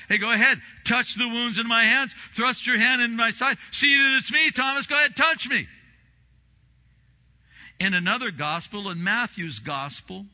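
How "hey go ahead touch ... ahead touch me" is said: loud, high, insistent invitation; emphatic urging. Retold or imitated. Jesus urging doubting Thomas to touch his wounds, loudly insistent. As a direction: press each command home with rising force.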